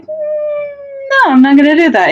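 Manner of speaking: a sing-song "no," pitched high; coy refusal. Retold or imitated. The thief to Arthur, a mocking refusal in a high voice. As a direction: tease him while refusing to hand it over. Norith refuses to return mirror.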